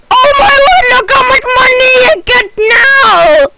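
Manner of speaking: high, loud astonished cry; thrilled gamer yell; amazed shout. Real, not acted. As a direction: shout it out in disbelief. Amazed at the money gained.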